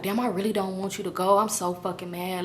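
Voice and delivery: soft, low mock dejection; understated, hushed upset; near tears, retold. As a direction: imitate him quietly, downcast. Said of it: "looked like he was finna start crying".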